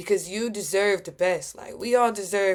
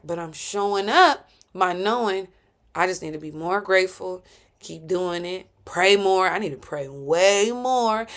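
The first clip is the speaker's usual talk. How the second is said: ordinary delivery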